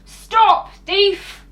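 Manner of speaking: loud angry yell after a thief; shouting. Acted. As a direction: bellow "Stop, thief!" across the garden. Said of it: "calling out".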